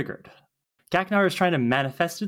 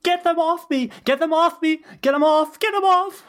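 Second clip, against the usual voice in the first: much higher, louder frantic shrieks; panicked screaming; 12 semitones higher, 5 dB louder than usual. Acted. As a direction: beg them to pull the bugs off. Willow attacked by sparks.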